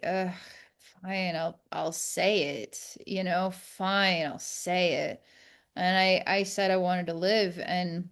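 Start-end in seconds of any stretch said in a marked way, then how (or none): 0.0-5.2 reluctant low mutter, giving in; grudging. Retold or imitated. Talking to herself, grudgingly giving in. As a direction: sigh it out like a sulking teen. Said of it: "I was like".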